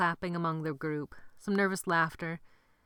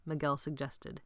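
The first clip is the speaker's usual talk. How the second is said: ordinary delivery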